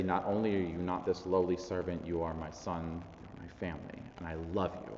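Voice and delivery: soft, low, loving affirmation; tender reassurance. Acted. Father telling his son he is family, tenderly.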